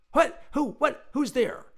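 high, loud bursts of questions; startled. A narrator's reading. Inventor startled by an unseen visitor, calling out in alarm. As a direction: fire out each word in alarm.

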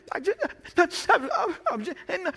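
high-pitched, choked mock sobbing; sobbing child. Hurt child runs crying to a parent, high mock sobs. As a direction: imitate a child too upset to get words out. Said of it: "she can't even speak".

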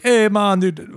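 put-on Caribbean accent. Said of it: "like, Islander woman who's like".

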